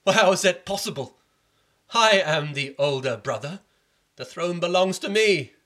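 elder prince protesting to the king; outraged prince. Acted. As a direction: claim the throne hotly. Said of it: "Ramagupta cried out".

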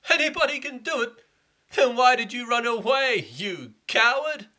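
high, loud mocking retort; scornful schoolboy taunt, high and loud; taunting friend. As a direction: jeer at him, dragging out coward. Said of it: "The friend said".

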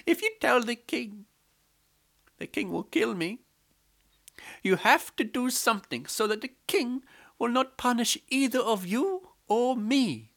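high, pleading, scared voice; frightened maidservant. A narrator's reading. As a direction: beg them to protect you. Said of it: "She came and told".

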